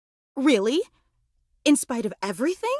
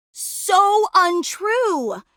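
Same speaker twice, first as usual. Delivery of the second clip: very slow, higher-pitched gushing denial; emphatic protest; 5 semitones higher, much slower than usual. Acted. Fan contradicting their modest hero, emphatic protest. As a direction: stretch both words out in protest.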